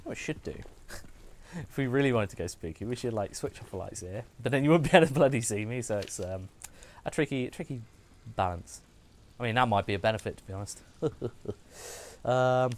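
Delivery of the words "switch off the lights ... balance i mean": ordinary talk, nothing marked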